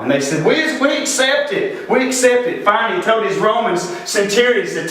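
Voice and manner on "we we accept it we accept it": high-pitched crowd reply; crowd calling back. Retold or imitated. Crowd accepting the blame from Pilate, high imitated call.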